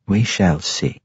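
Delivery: lightly voiced matron, even; a hint of a cool matriarch; matronly voice. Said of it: "said the matron mother".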